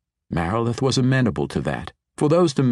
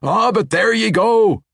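much higher, louder happy shout; cheering; 13 semitones higher, 7 dB louder than usual. A narrator's reading. Foreman cheering his crew's success, loud cheer. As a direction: cheer the crew on. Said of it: "Orethio cheered".

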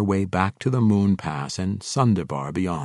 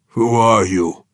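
louder, hard demand in another voice; 2 semitones higher, 8 dB louder, slower than usual. A narrator's reading. Orc warlord to sudden intruder, demanding. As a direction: demand to know at once. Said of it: "he demanded".

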